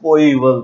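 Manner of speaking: कोईवल confirmed with a firm push; coeval said firmly. Shown as a demonstration. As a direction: confirm कोईवल clearly, stress on KO. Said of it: "you heard it right".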